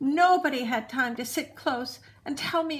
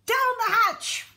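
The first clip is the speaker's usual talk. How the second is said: much higher, louder cheer; shouted; 12 semitones higher, 6 dB louder than usual. A narrator's reading. Crew answering the captain's toast, loud shout. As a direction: yell it back as a crew. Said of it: "we all shouted".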